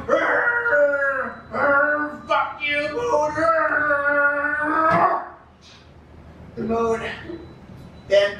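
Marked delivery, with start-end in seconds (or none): 0.1-5.2 strained growl squeezed out under a weight; crushed growling. Acted. Bukowski crushed under the moon, strained pained growl. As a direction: growl in pain while still cursing. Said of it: "Bukowski goes".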